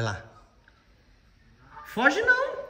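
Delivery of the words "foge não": much higher, sing-song calling of a dog; high coaxing call to the dog. Real, not acted.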